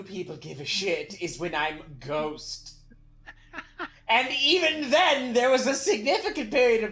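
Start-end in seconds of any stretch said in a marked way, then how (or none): 4.1-6.9 rising indignant complaint; building up to an offended yell; indignant outburst. Acted.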